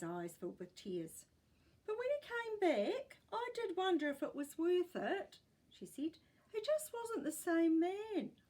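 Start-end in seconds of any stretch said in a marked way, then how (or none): 1.9-5.4 put-on old woman voice. A narrator's reading. Great gran voiced in story. 6.5-8.4 put-on old woman voice. A narrator's reading. Great gran voiced in story.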